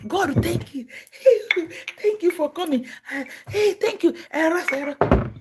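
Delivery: high, eager gushing thanks; mock gushing thanks. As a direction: fawn over the visitor with delight. Overjoyed believer continues.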